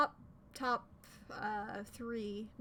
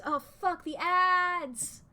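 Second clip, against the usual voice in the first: loud, higher yelp of annoyance at the ads; real frustrated outburst; 5 semitones higher, 7 dB louder, slower than usual. Real, not acted. Streamer annoyed as an ad interrupts the game, loud outburst. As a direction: blurt it out, annoyed the ads cut in.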